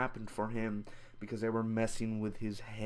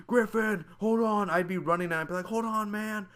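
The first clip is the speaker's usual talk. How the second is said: urgent dad call; 8 semitones higher than usual. Pretend. Imagined dad chasing the tree calls his son, higher urgent call. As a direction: call out to your son as you run.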